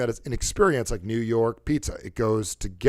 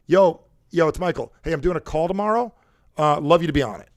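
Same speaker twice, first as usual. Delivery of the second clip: lively, louder buddy greeting; casual friend message; 4 semitones higher, 6 dB louder than usual. Shown as a demonstration. Messaging a friend about a call, casual and upbeat. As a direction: toss it off to a pal. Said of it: "send a message to your friend".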